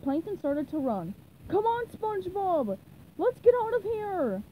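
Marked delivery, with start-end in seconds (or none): 1.5-4.4 high, hurried-sounding urging; raised, alarmed exclamation; urgent call. A narrator's reading. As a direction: urge a friend to flee. Plankton urges escape.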